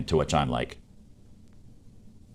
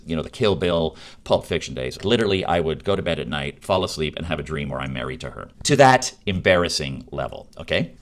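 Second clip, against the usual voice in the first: normal talking voice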